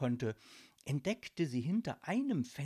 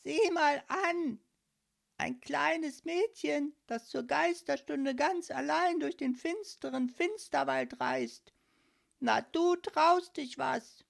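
high croak; 11 semitones higher, 5 dB louder than usual. A narrator's reading. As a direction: croak it out in amused astonishment. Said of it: "krächzte mit hoher Stimme".

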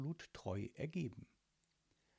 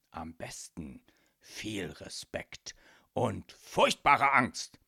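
slow hushed start swelling to a loud finish; threatening father spelling it out; menacing emphasis; 3 semitones lower, 7 dB louder, slower than usual. A narrator's reading. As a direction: draw it out breathily, then punch the last words. Menacing boss instructs daughter.

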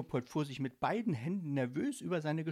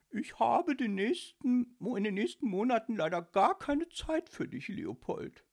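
high, haughty mimicking voice; Josephine; 11 semitones higher, 5 dB louder than usual. Ghost mocking his elf friend's refusal, disguised voice. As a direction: imitate a snooty lady turning him down. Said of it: "sprach mit verstellter Stimme".

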